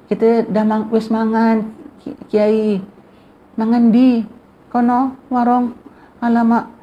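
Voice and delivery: high, put-on voice of the guest Dayo complaining in Javanese. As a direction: mimic the surprised guest who has already eaten. Dayo quoted speaking Javanese. (suara tetamu Dayo yang terkejut dalam bahasa Jawa)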